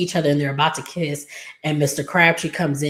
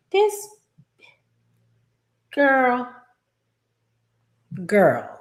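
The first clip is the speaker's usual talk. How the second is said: high, drawn-out reaction with long pauses; mock disbelief; 9 semitones higher, much slower than usual. Viewer reacts to lady asking for tea, exaggerated disbelief. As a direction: start very high, then drop on the last word.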